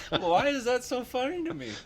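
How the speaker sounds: high, laughing question. Real, not acted. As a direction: ask it while still laughing. Laughing fit.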